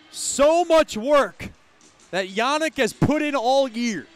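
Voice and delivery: beaming praise after the big goal; elated. Real, not acted.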